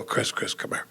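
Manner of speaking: hushed, low call to a friend; low and secretive; furtive call. Retold or imitated. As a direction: beckon someone over in a near whisper. Furtively calls friend over mid-conversation.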